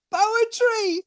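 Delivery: ordinary talk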